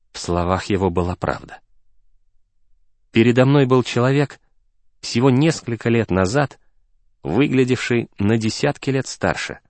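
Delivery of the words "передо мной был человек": plain reading voice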